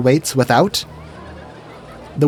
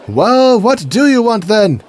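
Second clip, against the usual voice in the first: high, louder comic guard; impatient guard; 8 semitones higher, 4 dB louder, slower than usual. Retold or imitated. Guard losing patience with knight, high testy voice. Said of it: "the skit goes something like this".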